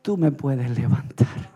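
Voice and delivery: low, slow pleading prayer to the Lord; groaning prayer. Retold or imitated. Sick man praying to God, low slow plea. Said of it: "yo gemía en mi corazón y yo decía".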